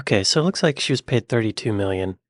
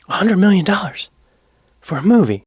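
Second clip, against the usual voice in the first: higher, louder incredulous outburst; outraged; 8 semitones higher, 6 dB louder than usual. Real, not acted. Viewer balking at the actor's huge fee, outraged. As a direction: exclaim it in loud, high-pitched indignation. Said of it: "It makes me angry".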